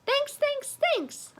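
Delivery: very high, tiny hamster voice; polite squeak. A narrator's reading. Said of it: "I replied politely".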